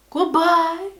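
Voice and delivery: high, loud, sing-song goodbye; cheery goodbye. Acted. She bids the outside world a loud cheery goodbye. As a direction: bid the world farewell gleefully. Waving off other thoughts.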